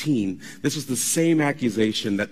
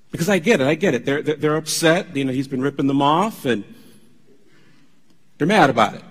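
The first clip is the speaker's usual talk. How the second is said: ordinary delivery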